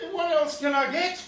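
a high, loud, indignant wife voice; high mock scolding wife. Puppet wife defending the sausages, high and loud. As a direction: nag back at him, high and loud.

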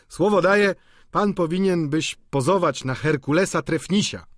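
high, loud exclamation across the table; bantering painter. Acted.

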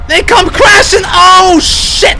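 high-pitched alarm building to a shout; rising panic. Real, not acted. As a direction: panic as they close in on you. Monsters crashing in during chase.

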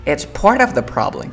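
"part of" joined without T; linked example. Shown as a demonstration. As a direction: read it dropping the T in "part of". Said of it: "voy a tratar de leer".

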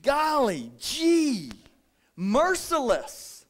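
high, slow, sing-song put-on voice; hammed-up prissy tut; mock shock. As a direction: drag it out in mock dismay. Mocking the rude customer.

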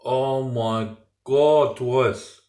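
very slow, drawn-out exclamation; savouring awe. Real, not acted. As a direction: stretch each word over a delicious mouthful. Reacting to tasting the pasta.

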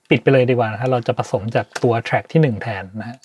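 ordinary talk, nothing marked

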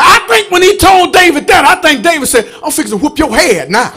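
loud, rising gospel preaching; full-force preaching. Acted. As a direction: preach it hard to the congregation.

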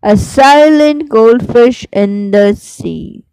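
slow, sing-song recital with rising line ends; poem recitation. Shown as a demonstration. Teacher reciting the lark poem for students, slow sing-song. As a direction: recite it like a class poem, each line lingering.

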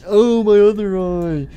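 loud, high, slow howl; pained wail. Acted. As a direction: wail in hammed-up agony. Second eye ripped out.

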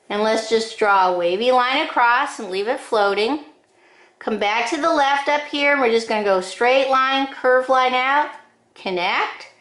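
ordinary talk

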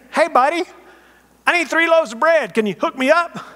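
high, loud chummy voice calling up from outside; pushy friend at night. Acted. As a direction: play the buddy begging for bread at midnight. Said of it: "you hear this voice say".

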